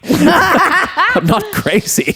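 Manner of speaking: put-on high, very slow laughing drunk voice; laughing mock Arthur. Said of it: "And so he goes".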